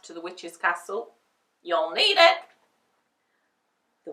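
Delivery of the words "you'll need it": high loud gatekeeper. A narrator's reading. Gatekeeper wishes them luck, high and loud. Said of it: "said the gatekeeper".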